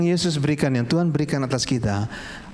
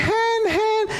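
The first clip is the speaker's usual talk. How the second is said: put-on very high, piercing little-kid cries; imitated child's screaming. Father imitating his child in the dark tunnel, high screaming. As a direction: shriek each word separately, imitating a scared child. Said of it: "dia teriak-teriak".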